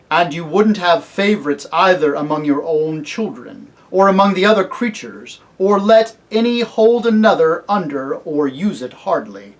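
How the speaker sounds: loud, stern questioning; solemn kingly lion. Acted. Aslan warning the cabbie against favorites, solemn. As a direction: press the question with royal authority.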